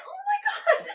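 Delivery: very high, loud cry-laugh shout; laughing mock outrage. Laughing disbelief.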